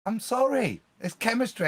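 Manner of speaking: giddy, unable to calm down; excited. Real, not acted. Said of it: "I get excited".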